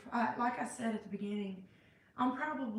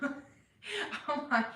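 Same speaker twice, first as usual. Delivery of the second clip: self-mocking laughter; 5 semitones higher than usual. Real, not acted. Laughs at feeling a failure.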